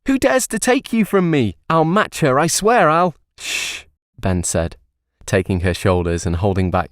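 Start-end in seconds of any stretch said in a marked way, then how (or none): 0.1-3.2 hammed-up despair; hysterical. A narrator's reading. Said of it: "broke out into hysterics". 3.4-3.8 soft unvoiced shush; quiet her down; whispered hush. A narrator's reading. Ben hushes her.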